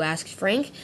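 plain reading voice

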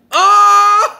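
very high, loud laughing scream; shrieking with laughter at the punchline. Retold or imitated. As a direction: scream then dissolve into laughter. She gets the joke, screams.

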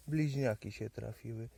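ordinary delivery

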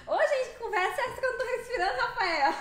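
high-energy rapid comeback; fast talking. Real, not acted. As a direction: rattle it off with amused indignation. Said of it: "é o costume de falar rápido".

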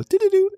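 high sung text-alert tones; little electronic jingle, voiced by mouth; imitated phone chime. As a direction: mimic a phone's notification beeps. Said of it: "it would go".

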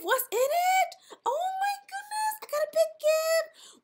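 very high excited squeal; squealing delight. Real, not acted. Player opening Santa's big gift, high squeals. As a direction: shriek with joy at the big present.

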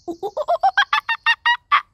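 loud monkey whoops, climbing very high; monkey hooting. Pretend. Teacher plays monkey for children, high loud hooting. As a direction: hoot like a monkey, building to shrieks.

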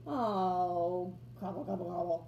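lightly grumbled gobbles; grumbling. A narrator's reading. Turkey caught by the child, mildly grumbling. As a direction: gobble under your breath in mild annoyance. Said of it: "grumbled Turkey".

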